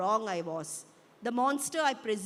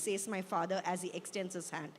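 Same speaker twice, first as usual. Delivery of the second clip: ordinary delivery, nothing marked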